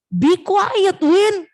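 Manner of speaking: high-pitched storybook scolding; acted yelling. Big mouse shouts at the wind to hush, mock yell. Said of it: "Dia teriak-teriak".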